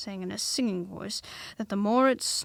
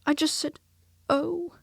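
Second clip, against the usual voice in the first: nervously; 7 semitones higher, 6 dB louder than usual. Acted. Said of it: "said Piglet nervously".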